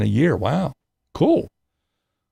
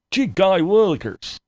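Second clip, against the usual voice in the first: put-on higher, gee-whiz innocent kid voice; wholesome 1950s kid; 7 semitones higher than usual. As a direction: gush it like a wide-eyed kid. Mock innocent exclamation.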